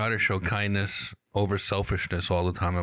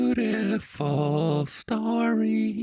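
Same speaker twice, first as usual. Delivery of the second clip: high, very slow fairy praise; drawn-out falsetto admiration; fairy singsong; 10 semitones higher, much slower than usual. Pretend. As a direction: coo it in long high notes. Fairy reacts to the letter.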